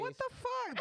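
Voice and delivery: real high-pitched disbelief; incredulous. Real, not acted. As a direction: squawk in amused disbelief. Listener reacts to Febreze habit.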